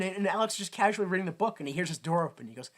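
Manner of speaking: ordinary talk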